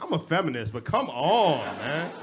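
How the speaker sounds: loud mock exasperation; exasperated outburst. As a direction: throw up your hands at the class.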